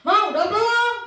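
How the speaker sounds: loud, high call to mom; imitated yell. Daughter yelling from bedroom to mother, high impatient shout. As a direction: shout impatiently toward the next room. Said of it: "Suara saya nadanya tinggi".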